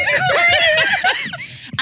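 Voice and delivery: loud, very high peal of laughter; cackling. Real, not acted. Wife overcome by her own story, shrieking laughter. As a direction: burst out laughing, uncontrollably.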